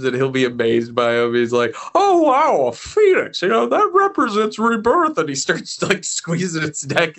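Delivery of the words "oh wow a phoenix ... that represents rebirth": Jimmy Stewart voice. Retold or imitated. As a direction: marvel at the phoenix like Jimmy Stewart. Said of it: "Jimmy Stewart voice".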